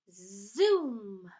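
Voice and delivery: loud drawn-out engine zoom; swelling "zoom" of a chair taking off; zoom noise. Acted. Storyteller voices a wheelchair taking off, loud zoom. Narrator imitates chair speeding off.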